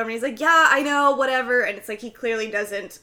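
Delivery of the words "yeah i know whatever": louder, brushing her off; mock talk-over, dismissive and loud. Boyfriend cutting off Danny, dismissive and loud. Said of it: "he is talking right over".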